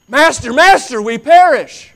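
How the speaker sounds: high, loud frantic cry; desperate shout. Acted. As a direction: shout in panic to the master. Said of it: "the desperation".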